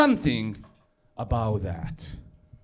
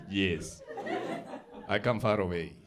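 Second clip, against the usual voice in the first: lower, terse foreigner's reply; lightly played young foreigner; 6 semitones lower, 7 dB quieter than usual. Young foreigner answering an American woman, lightly played low voice. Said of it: "I was like".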